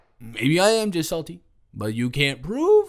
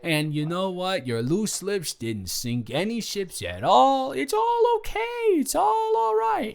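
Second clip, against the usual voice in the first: sweet soothing mock; 4 semitones higher than usual. Imagined kind words to drunk Colin, high and sing-song. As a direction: coo it like a nurse calming a drunk. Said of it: "And I can't just say".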